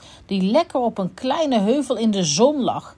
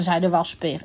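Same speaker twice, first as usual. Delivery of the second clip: plain reading voice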